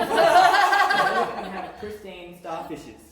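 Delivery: giggling over his own line; drawn-out laughing delivery; laughing punchline. Real, not acted.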